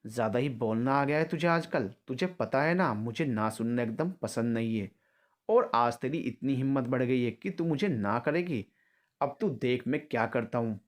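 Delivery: usual speaking voice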